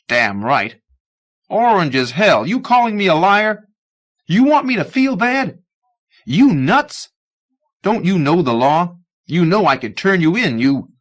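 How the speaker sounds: put-on young man threatens the old man, furious; angry rant. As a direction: lash out at him, voice climbing. Young man's angry tirade.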